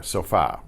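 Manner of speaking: sofa with stress on FA; last-syllable stress. Shown as a demonstration. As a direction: lean on the final syllable of sofa. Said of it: "there's an accent mark written on that".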